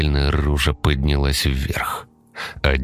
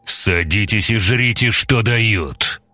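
gruff bass voice; 6 semitones higher, slower than usual. Acted. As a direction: bark the order roughly. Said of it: "сделался грубым, басистым".

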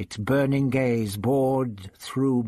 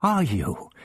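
higher, halting, fearful voice; scared, broken-up question; frightened faltering question; 9 semitones higher, 3 dB louder, slower than usual. A narrator's reading. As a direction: falter in amazement and fear. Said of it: "she whispered".

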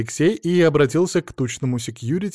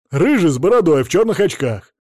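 loud, high heated description; raised-voice insistent answer; agitated witness; 5 semitones higher, 8 dB louder than usual. A narrator's reading. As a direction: call out the description, worked up.

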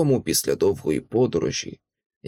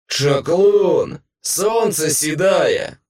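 loud, high, drawn-out gloating cry; victorious creature bellowing; triumphant monster howl; 5 semitones higher, 5 dB louder, much slower than usual. A narrator's reading. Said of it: "завив монстр переможно".

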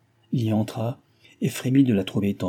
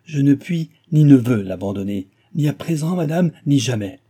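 loud, higher vow; defiant cry; 4 semitones higher, 7 dB louder than usual. Acted. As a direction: refuse her to her face. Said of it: "il s'écria".

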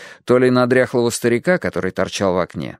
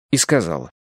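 usual speaking voice

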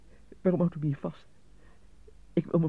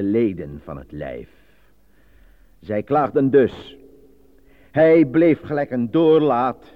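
declaiming Menenius Agrippa; 3 semitones higher, 6 dB louder than usual. Acted. Actor reciting his classical role into the microphone, loud and declaiming. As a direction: proclaim the fable to the rebelling crowd. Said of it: "aangezien ik Menenius Agrippa heb ingestudeerd".

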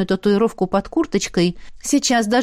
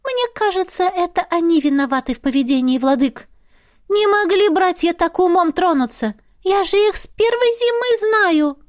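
high, squeaky little spirit, businesslike; 8 semitones higher than usual. Acted. Spirit talking business with the heroine, high squeaky voice. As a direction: pipe it up like a small sprite.

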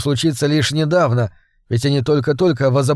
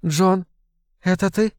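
husky Sarah; 5 semitones higher than usual. A narrator's reading. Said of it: "более грудной и хриплый".

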